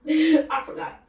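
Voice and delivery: embarrassed laughing repeat. Real, not acted. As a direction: repeat it while cracking up.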